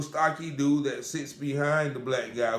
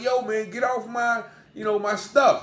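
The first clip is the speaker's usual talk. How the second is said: irritated demand; 8 semitones higher, 4 dB louder than usual. Retold or imitated. Man protecting his notebook.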